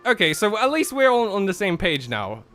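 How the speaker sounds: ordinary talk, nothing marked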